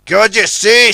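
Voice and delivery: loud, sharp retort; snap. Acted. Big Mac snaps at Ten Cents, loud. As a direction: bite back impatiently. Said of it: "snapped Big Mac".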